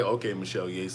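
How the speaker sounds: dropped-down, quiet baritone imitation; mock-deep, hushed reply. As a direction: sink deep and soft, mimicking a low-voiced woman. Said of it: "my voice is lower".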